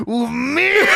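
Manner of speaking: high-pitched hammed-up wrestler yell; over-the-top imitation of a furious wrestler's yell; Macho Man. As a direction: yell it in mock outrage. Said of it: "And Macho Man yells".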